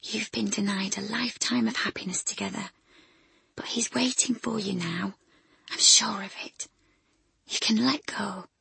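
hushed, tender whisper at a deathbed. Acted. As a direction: whisper comfort to a dying old woman. Ellen comforts dying Gertie softly.

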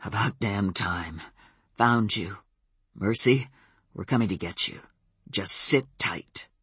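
steady him down, deep and in command; controlled. Acted. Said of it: "then much more controlled".

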